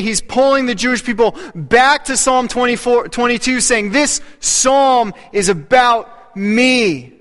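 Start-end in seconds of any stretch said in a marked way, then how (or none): 3.9-7.1 each word drawn out slowly; slow emphatic claim. Acted. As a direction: lean hard into this psalm, slowly. Preacher voicing Jesus' claim.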